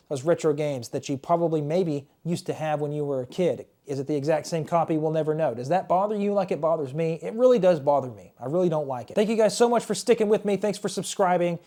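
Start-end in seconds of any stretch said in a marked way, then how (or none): none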